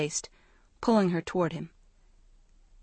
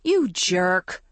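mock angry cry; 4 dB louder than usual. A narrator's reading. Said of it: "she cried".

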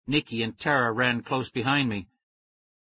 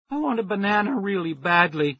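scratchy, high; 7 semitones higher, 3 dB louder than usual. A narrator's reading. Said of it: "My voice came out scratchy and high".

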